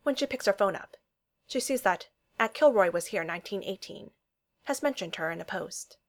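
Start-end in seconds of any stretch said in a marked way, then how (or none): none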